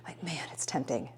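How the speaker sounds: breathy conspiratorial whisper, almost no voice. Real, not acted. Vlogger tempted by five-dollar turkeys, whispered aside. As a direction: let the temptation slip out as a hushed secret.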